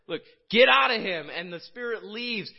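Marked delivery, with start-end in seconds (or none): 0.1-1.3 forceful command. Retold or imitated. Jesus commanding the spirit.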